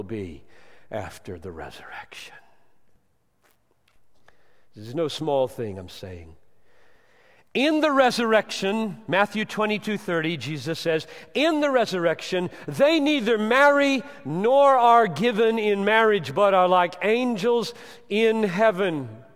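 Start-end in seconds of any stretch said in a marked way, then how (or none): 0.0-2.3 sudden hushed whisper; breathy awed whisper. Acted. Sudden drop after loud preaching. 7.5-19.2 loud, high preaching at full force; thundering sermon voice; fiery preaching. Acted. Preaching scripture quote.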